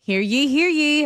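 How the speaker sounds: town crier. Acted. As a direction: call out like a herald in the square. Ad opens as royal summons.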